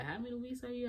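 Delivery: retold question. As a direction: ask it high and light, as someone else. Retold question from clinic staff.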